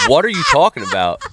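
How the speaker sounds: lightly voiced German teen accent; mock German accent. The German kid asks him, in a light mock German accent. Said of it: "with this stupid German accent".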